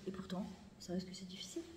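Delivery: a real whisper, almost breathless; whispered aside. Real, not acted. Speaker to women, whispering the hard part. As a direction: whisper it as a private confession.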